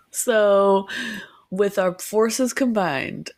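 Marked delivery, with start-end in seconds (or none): none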